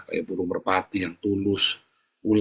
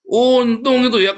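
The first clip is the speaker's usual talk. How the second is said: ordinary delivery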